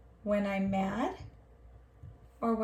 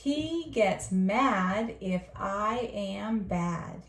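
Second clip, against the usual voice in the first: slow, clear reading of each word; slow model reading; 2 semitones lower, much slower than usual. Shown as a demonstration. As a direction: read it slowly for the class to copy. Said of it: "Your turn".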